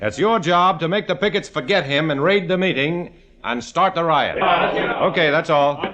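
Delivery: briefing the squad. Acted. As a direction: take charge and hold the room.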